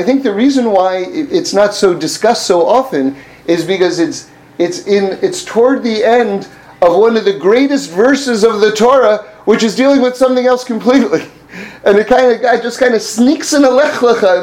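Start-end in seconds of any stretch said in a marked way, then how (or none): none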